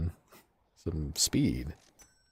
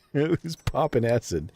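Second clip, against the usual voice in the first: slower, higher, still laughing; amused, laughing disbelief; laughing question; 6 semitones higher, slower than usual. Real, not acted. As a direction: ask through the laughter. Laughing at chat.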